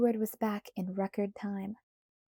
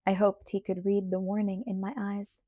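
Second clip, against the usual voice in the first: usual speaking voice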